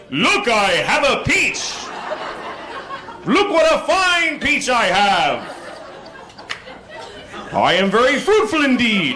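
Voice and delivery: slow, high, grand proclamation; proud peach waver. Retold or imitated. Waving a peach at a friend across the street, slow and grandly proud. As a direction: announce the peach like royalty, slowly. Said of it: "I felt like I was going".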